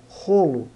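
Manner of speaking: "rolo" with a strong Brazilian throaty R, "holo"; teacher modelling "rolo"; Portuguese R of rolo. Shown as a demonstration. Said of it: "não é o R de rolo".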